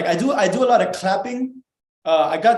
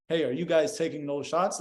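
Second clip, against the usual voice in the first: subdued, softer stiff question; weird, awkward way; 3 semitones lower, 5 dB quieter than usual. Shown as a demonstration. Showing the wrong approach to strangers about shots, weird and low-energy. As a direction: ask it awkwardly, with the fun drained out. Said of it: "in a very weird ass way".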